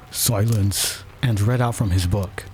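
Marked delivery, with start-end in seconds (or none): none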